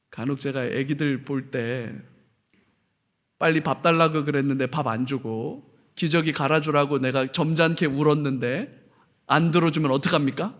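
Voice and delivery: ordinary delivery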